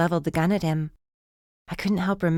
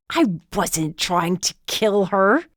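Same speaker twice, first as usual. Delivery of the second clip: disdainful; 2 semitones higher, much slower than usual. A narrator's reading. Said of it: "said it with disdain".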